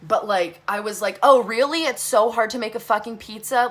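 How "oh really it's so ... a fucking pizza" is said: loud, mocking incredulity; sarcastic retort. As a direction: throw it back at the caller, loud and scornful.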